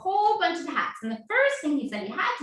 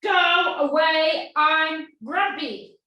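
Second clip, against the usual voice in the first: grumpy lion; 3 semitones higher, 8 dB louder, much slower than usual. Acted. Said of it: "Lion did not want any visitors".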